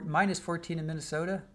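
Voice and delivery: ordinary delivery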